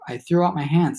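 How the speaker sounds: ordinary delivery, nothing marked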